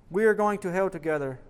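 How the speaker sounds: usual speaking voice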